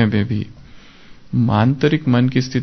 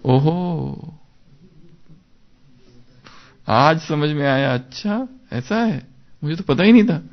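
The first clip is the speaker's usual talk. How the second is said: higher, wide-eyed ओहो and अच्छा; amazed realization; 4 semitones higher than usual. Shown as a demonstration. Teacher showing students how to read in wonder, amazed. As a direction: sound as if it just dawned on you. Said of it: "आश्चर्य से पढ़ना है उसको".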